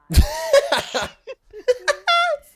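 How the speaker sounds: loud high-pitched burst of laughter. Real, not acted. Big laugh at game prank.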